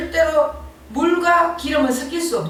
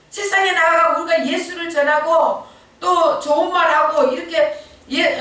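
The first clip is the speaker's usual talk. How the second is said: normal talking voice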